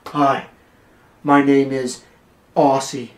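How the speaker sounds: a parody of a tough-guy greeting; mock intimidating voice. Shown as a demonstration. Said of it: "nobody talks like that".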